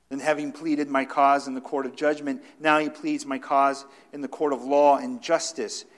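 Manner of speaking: full-voiced sermon quote; preaching. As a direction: proclaim it from the pulpit.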